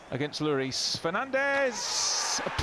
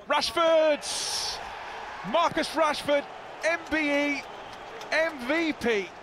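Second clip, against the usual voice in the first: excited goal call; 4 semitones higher, slower than usual. Real, not acted. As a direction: roar the scorer's name. Goal called live.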